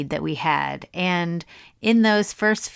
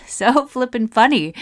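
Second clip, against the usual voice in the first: laughing while gushing, a bit louder; 5 semitones higher, 5 dB louder than usual. Real, not acted. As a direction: giggle through the words. Gushing about funny people online.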